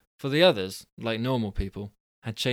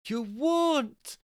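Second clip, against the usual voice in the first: high shouted "You won't"; shouted refusal; 13 semitones higher than usual. Acted. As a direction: yell it at him, pitched way up. Quarrel escalates, wife shouts.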